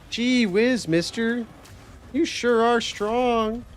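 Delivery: put-on high, slow gee-whiz kid voice; wide-eyed small-town kid in awe; wholesome 1950s kid. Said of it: "Are you quoting Hercules?".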